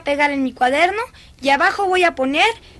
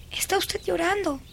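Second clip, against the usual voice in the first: softer, tentative noticing; hushed realization; 3 semitones higher, 7 dB quieter than usual. Acted. Girl notices governess's tears, hushed. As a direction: say it softly as you notice.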